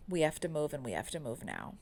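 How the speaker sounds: fading into tearful quiet; choked up. Real, not acted. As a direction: voice drops as emotion hits. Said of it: "It still gets me".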